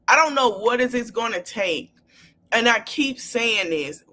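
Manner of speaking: normal talking voice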